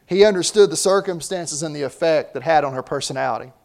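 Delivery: ordinary delivery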